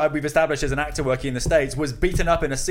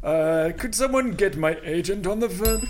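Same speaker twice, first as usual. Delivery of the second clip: mock olde english; slower than usual. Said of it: "Is that what Derek Jacobi sounds like?".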